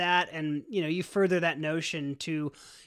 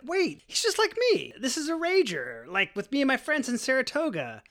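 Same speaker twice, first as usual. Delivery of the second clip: higher-pitched, dawning discovery; retold inner voice, pitched up as it clicks; sudden realisation; 8 semitones higher than usual. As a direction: think it aloud in mild amazement. Acted sudden realisation, watching dad party.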